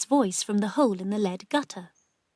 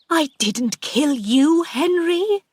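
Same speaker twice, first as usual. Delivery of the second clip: high, loud, slow protest; frightened denial; 6 semitones higher, 7 dB louder, slower than usual. A narrator's reading. Aunt denying murder to Henry's ghost, frightened. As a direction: protest in alarm, voice climbing.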